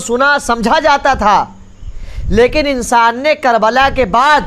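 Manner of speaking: impassioned sermon climax; full-force preaching. Acted. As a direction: preach it out to the whole hall. Majlis preacher rising to climax.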